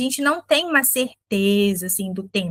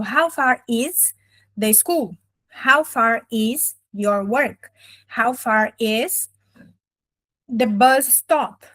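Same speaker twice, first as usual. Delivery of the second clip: slow teaching example; much slower than usual. Shown as a demonstration. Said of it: "por exemplo".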